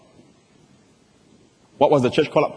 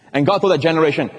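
usual speaking voice

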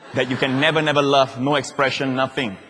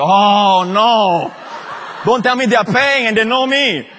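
higher, louder laughing alarm; embarrassed alarm; 8 semitones higher, 8 dB louder, slower than usual. Retold or imitated. Pastor fearing the family paid, laughing. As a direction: panic in fun at being recognised. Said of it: "I said".

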